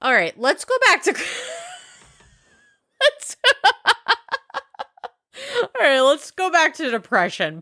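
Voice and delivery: high pitched giggling through the words; laughing fit. Real, not acted. Vlogger laughing at her own stoned mistake, giggling high. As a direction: crack up, trying to get back on track.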